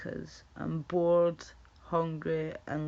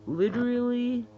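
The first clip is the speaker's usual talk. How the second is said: put-on higher, louder girly "Literally"; mock valley girl; 6 semitones higher, 7 dB louder than usual. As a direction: ham up the gushing "Literally". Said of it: "why do lassies say that?".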